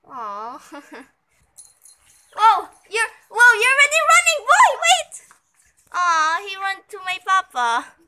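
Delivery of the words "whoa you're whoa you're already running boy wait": loud, higher, fast startled whoa; excited surprise. Real, not acted. Vlogger shouts in surprise as his puppy runs off. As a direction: burst out as the puppy bolts.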